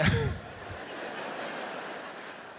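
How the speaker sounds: airy laugh. Real, not acted. Laugh mid-story.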